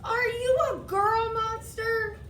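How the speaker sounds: surprised. A narrator's reading. Boy asks in surprise.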